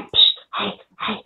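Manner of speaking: hushed, breathy whisper of a coworker; whispered gossip. Retold or imitated. Coworker whispering gossip from her break room, hushed whisper. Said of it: "she's like".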